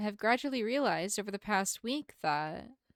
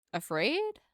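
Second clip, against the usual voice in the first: surprised echo; 5 semitones higher, 3 dB louder than usual. Acted. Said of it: "I'm simply afraid".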